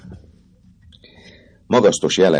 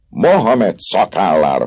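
a raised, slowed oath; exclaiming oath; 7 semitones higher, 4 dB louder than usual. Acted. Vagabond Trouillefou swears an oath, mild raised exclamation. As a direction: swear it out with a bit of force. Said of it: "kiáltotta Trójfú".

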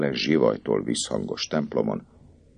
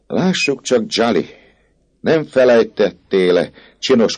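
higher, louder, delighted cry; enthusiastic exclaim; 7 semitones higher, 4 dB louder than usual. Acted. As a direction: gush over the goat with joy. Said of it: "lelkesen felkiáltott". (lelkes, magasabb felkiáltás)

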